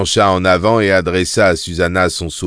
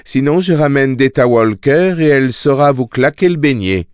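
higher-pitched threat; warning; 6 semitones higher, 4 dB louder than usual. A narrator's reading. Susanna threatens to bring back Detta, warning. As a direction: warn them what follows if they persist. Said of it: "l'avertit Susanna".

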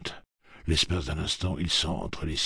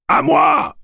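much higher, loud war cry; battle yell; 14 dB louder than usual. Acted. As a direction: yell it at full force. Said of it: "hurle Roland de Gilead".

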